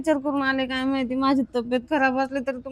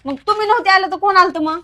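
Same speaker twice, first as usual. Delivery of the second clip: loud, high, demanding; angry shout; 6 semitones higher, 9 dB louder than usual. Acted. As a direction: hurl the question at him. Wife demanding answer.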